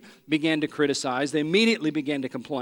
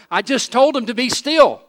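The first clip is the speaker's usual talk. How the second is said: louder and higher, exasperated protest; exasperated Moses; 7 semitones higher, 8 dB louder than usual. Retold or imitated. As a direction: burst out in disbelief. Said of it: "To which Moses probably thought".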